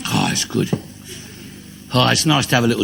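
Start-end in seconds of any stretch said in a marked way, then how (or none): 0.1-0.7 deep contented "Oh" after a sip; low satisfied groan. Pretend. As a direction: drop very low and savour it, a drinker's contented sigh. Savouring a drink.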